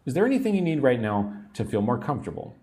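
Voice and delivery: rattled off quickly like a busy nurse; fast natural speed. Shown as a demonstration. Teacher shows learners the quick natural version. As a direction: run it together at real speed. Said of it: "teacher models phrase twice".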